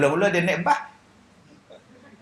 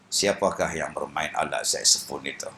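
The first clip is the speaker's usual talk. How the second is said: blind man voice; 6 dB quieter than usual. Retold or imitated. Blind Kasim voiced in story.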